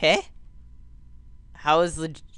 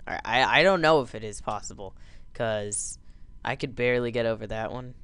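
normal talking voice

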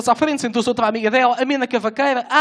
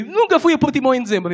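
repeated quote; 6 semitones higher, 4 dB louder than usual. Retold or imitated. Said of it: "Não entendi".

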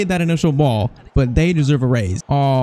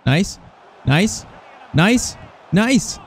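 rising nice; slower than usual. Real, not acted. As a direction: repeat it with a climbing, rising lilt. Reacting to good play, climbing repeats.

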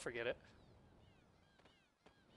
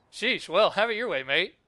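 loud, higher, miffed character voice; acted huff; 6 semitones higher, 10 dB louder than usual. Kid rebuffed by Serge, acted huffy. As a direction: give up on them with a loud huff.

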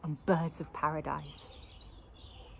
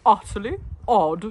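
mused; 2 semitones higher, 9 dB louder than usual. A narrator's reading. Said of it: "he mused".

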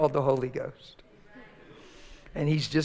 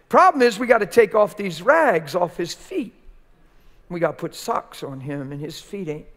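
normal talking voice

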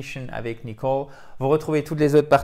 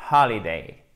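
"Holiday" with HOL loud and clear; stress on HOL; 3 semitones lower, 5 dB louder than usual. Shown as a demonstration. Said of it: "sur la première syllabe".

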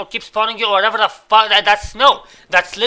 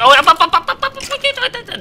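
rising squeal; 4 dB louder than usual. Real, not acted. Gamer squealing as the cart climbs up. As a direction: ride the pitch up with the cart.

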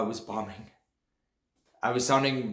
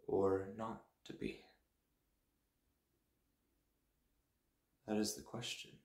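Shakespeare recitation; 2 semitones lower, 9 dB quieter than usual. Acted. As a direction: speak it hushed and inward. Recites Hamlet's soliloquy.

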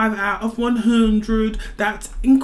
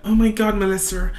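ordinary delivery

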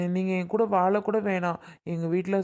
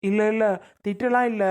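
hasty loud denial; 3 semitones higher, 5 dB louder than usual. Acted. Husband defends bride to elder.

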